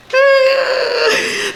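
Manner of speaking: high squealing laugh at herself; shrieking laugh. Real, not acted. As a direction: let a high laugh burst out. Laughs at own reveal.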